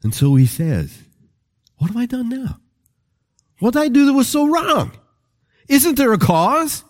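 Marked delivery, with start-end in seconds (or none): 1.8-6.8 high-pitched, plaintive pleading; wounded protest. Acted. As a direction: play the scolded kid protesting innocence. David protests to upset brothers.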